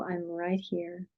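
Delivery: soft, slow, steady reassurance; calm reassurance. Shown as a demonstration. Parent staying beside a crying child, soft and calm. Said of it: "and you go".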